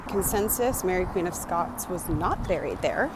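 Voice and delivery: normal talking voice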